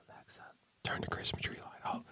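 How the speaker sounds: re-enacted hushed whisper to a smart speaker. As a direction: whisper it so a sleeper won't wake. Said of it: "you sit and whisper to Siri".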